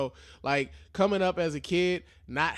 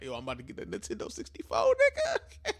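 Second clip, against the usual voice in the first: put-on excited kid. Kid ready for Christmas presents, giddy squeal. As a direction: build to a squealed last word. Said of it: "I feel like a kid".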